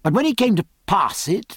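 ordinary delivery

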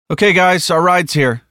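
loud, high call to the group. Acted. Martinez to the waiting group as the vehicle is ready, calling out.

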